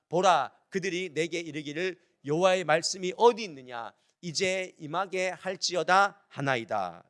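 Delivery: measured, projected verse reading; deliberate Bible recitation, slowing at the close; scripture reading. Acted. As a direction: lead a congregation reading the verse aloud. Leads congregation in reading verse.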